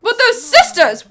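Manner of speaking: high, loud, slow protest; shocked objection. Acted. Listener asks why a sister would sneak around, shocked.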